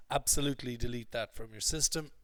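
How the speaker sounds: ordinary delivery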